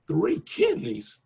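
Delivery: high, slow, drawn-out shock; gasping at the impossible news; stunned disbelief. Retold or imitated. Staff in the operating room, stunned and disbelieving. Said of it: "And everybody went".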